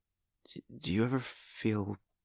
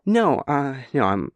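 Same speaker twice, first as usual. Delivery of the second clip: flustered louder protest; 9 dB louder than usual. Acted. Flustered reassurance after the accident.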